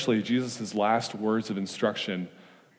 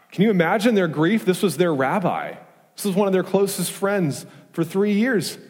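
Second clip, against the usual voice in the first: higher and louder, pleading with the church; impassioned preaching; 6 semitones higher, 4 dB louder than usual. Acted. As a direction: make them feel the disciples' fear. Preacher evokes disciples' grief.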